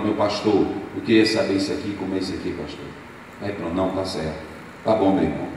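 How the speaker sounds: hushed, deferential mumble; timid murmur. Retold or imitated. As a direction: mimic a timid church member. Meek members speaking to pastor.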